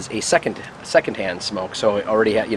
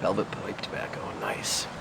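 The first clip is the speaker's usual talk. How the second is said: whispered; 13 semitones lower, 9 dB quieter than usual. Real, not acted. Savoring smoke, murmuring to himself.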